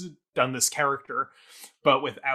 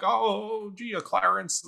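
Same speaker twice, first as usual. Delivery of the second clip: bad Jimmy Stewart impression; 8 semitones higher, slower than usual. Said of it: "but terrible".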